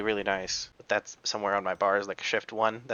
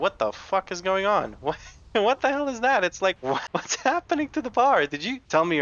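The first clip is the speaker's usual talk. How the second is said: high, loud burst of shocked disbelief with laughter; can't believe what the bar is showing, laughing; 9 semitones higher, 4 dB louder than usual. Real, not acted. Shocked at bugged progress bar.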